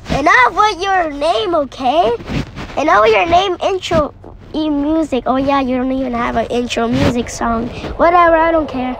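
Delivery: usual speaking voice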